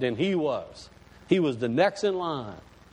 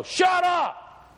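sudden high-pitched yell; yelling at a dog; 14 semitones higher, 3 dB louder than usual. Retold or imitated. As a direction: holler it out the door at a noisy dog. Acts man yelling at barking dog.

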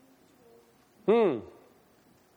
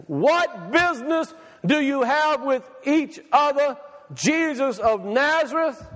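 slow, raised-pitch, declamatory spirit voice; unclean spirit's challenge; 6 semitones higher, slower than usual. Acted. Preacher voicing the spirit confronting Jesus, slow and declamatory. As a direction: draw each phrase out in a high, emphatic challenge. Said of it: "this is the spirit saying this".

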